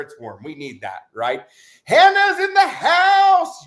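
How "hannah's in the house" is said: loud, high, slow announcer call; hype announcer shout. Real, not acted. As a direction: boom it like a ring announcer. Announcing a viewer.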